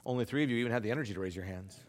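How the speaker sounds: normal talking voice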